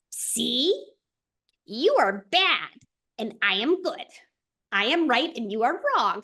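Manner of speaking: lightly playing the gloating ego; smug ego voice. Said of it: "shame and ego ... be like".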